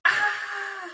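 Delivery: high, loud scream; frightened scream. Acted. Sam screaming at the Jack in the Box. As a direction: shriek at the pop-up. Spider scare.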